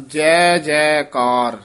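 ਜੈ ਜੈਕਾਰ slow, each ਜੈ apart; separate ਜੈ ਜੈਕਾਰ. Shown as a demonstration. Teacher showing the student ਜੈ ਜੈਕਾਰ, slow and separate. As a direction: space out ਜੈ ਜੈਕਾਰ slowly. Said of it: "ਇਸ ਤਰ੍ਹਾਂ ਉਚਾਰਨ ਕਰਨਾ ਹੈ".

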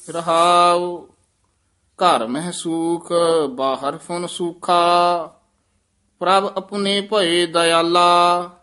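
measured, chant-like recitation of the hymn; scripture recitation. Acted. Teacher recites the hymn for the class, measured and devout. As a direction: read the verse slowly with devotion.

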